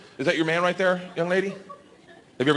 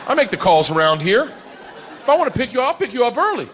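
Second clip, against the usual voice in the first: mock tough-guy bravado; 7 semitones higher than usual. Dad boasting to his daughter in his head, hammed-up swagger. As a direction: puff up like the boss of the house. Dad's inner bravado, acted.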